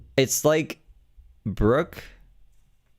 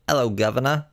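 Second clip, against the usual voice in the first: mock British accent; 6 semitones higher than usual. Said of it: "I thought it was Southern".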